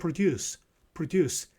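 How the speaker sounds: produce with stress on the second syllable, pro-DUCE; verb stress. Shown as a demonstration. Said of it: "동사로 쓰일 때는 강세가 뒤에 있어요".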